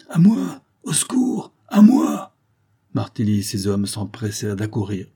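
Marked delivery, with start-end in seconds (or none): 0.1-2.3 high, loud shouts in short bursts; cry for help. A narrator's reading. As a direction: call out urgently for rescue. Villain calls her men for help.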